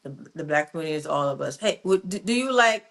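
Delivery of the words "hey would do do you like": ordinary talk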